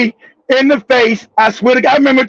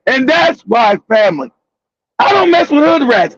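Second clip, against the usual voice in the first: ordinary talk, nothing marked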